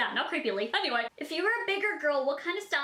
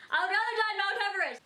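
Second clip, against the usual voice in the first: fast, higher, words through laughter; giggling quick reply; laughing; 7 semitones higher, much faster than usual. Real, not acted. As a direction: answer while still cracking up. Answers while still laughing.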